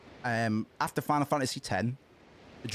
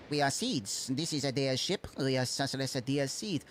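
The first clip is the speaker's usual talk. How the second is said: put-on semi-French accent. Streamer voicing alien crew, light French Slavic accent. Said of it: "we'll make these guys semi-French as well".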